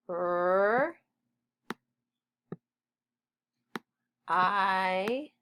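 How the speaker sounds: R as a long rrr, I as a long eye; sounded out slowly. Shown as a demonstration. Teacher sounding out right while writing, drawn-out sounds. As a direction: stretch R and I with a long pause between. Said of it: "Our next word is right".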